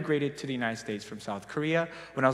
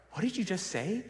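higher, softer incredulous question; taken aback; 4 semitones higher, 7 dB quieter than usual. Retold or imitated. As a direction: imitate baffled friends, voice raised in pitch but softened. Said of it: "And they're like".

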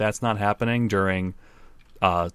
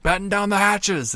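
dramatic shout; 12 semitones higher than usual. Retold or imitated. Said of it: "And he's like".

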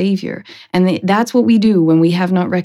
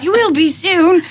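higher mock-menacing retort; cart customer; 10 semitones higher than usual. Man answering the body he carries, higher. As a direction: brush him off, pitched up. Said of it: "And he goes".

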